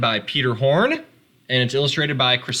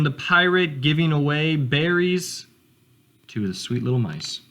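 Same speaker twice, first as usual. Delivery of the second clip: ordinary delivery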